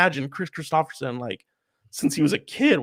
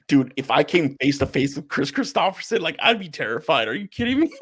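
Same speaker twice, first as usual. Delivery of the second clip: half laughing, mock terrified; giggling mock fear; laughing disbelief. Retelling own scared reaction laughing.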